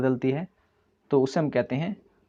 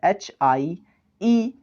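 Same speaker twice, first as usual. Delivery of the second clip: slow spelling; much slower than usual. Shown as a demonstration. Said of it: "spelling है".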